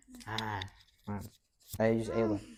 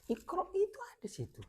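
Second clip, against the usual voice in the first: surprised; 9 dB quieter than usual. Acted. Teacher points to the text.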